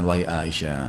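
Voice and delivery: soft, low address to a wife; gentle, courteous. Retold or imitated. Prophet addressing Aisyah, gentle and courteous. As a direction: speak low and softly, with courtesy. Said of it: "dengan suara baik, santun".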